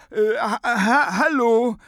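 high, loud, spluttering hello; flustered head-in-a-jar voice; stammering greeting. A narrator's reading. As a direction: stumble repeatedly before getting the word out. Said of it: "grüßte er".

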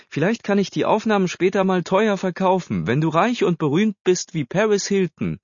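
high, animated teasing voice; playful teasing. A narrator's reading. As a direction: pitch it high and tease her along. Boy teases girl he is filming. (neckisch und aufgedreht, mit hoher Stimme)

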